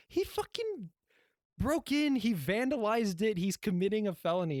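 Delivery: very high, incredulous protest; exasperated outrage. Acted. Arguing that the fence cutter is the criminal, exasperated outrage. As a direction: argue it in hammed-up disbelief, pitch shooting up. Outraged at inspector blaming crew.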